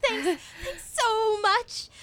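high, drawn-out thanks with a giggle; elated thanks. Acted. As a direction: gush it with relieved laughter. Grateful friend after the hug.

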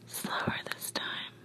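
barely audible whisper; whispered request. Acted. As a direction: whisper it very softly to a lover. Tender instruction acted in story.

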